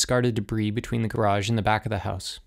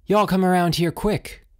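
higher and louder, calling them over; high urgent call; 9 semitones higher, 4 dB louder than usual. A narrator's reading. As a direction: shout it to friends across the yard. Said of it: "called in a high, thin voice".

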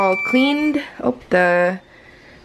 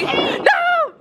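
squeal. Real, not acted. Loses the race to the car.

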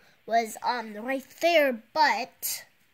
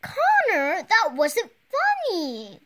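annoyed; 6 semitones higher, 4 dB louder than usual. Acted. Annoyed scolding in story.

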